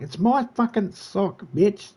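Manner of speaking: high, slow possessive scolding; mock scolding the cat. Real, not acted. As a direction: tell off a thieving pet. Scolding the cat over a sock.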